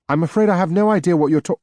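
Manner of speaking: rushed, high, bewildered protest; flustered and cut off. Acted. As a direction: blurt it out in confusion.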